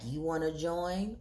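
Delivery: low, soft proposition; hushed, deep come-on; suggestive invitation. Retold or imitated. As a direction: imitate a man slyly inviting her in. Man propositions her in retold scene.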